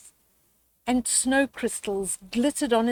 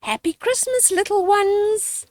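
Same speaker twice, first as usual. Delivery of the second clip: smiling mum; 9 semitones higher, 3 dB louder than usual. Acted. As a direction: greet the little ones with a smile. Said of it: "smiled Mum".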